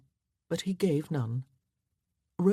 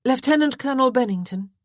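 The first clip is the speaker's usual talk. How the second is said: higher, louder call to stop him; calling after him; 9 semitones higher, 8 dB louder, faster than usual. A narrator's reading. Miss Westcott calls the departing colonel back, loudly.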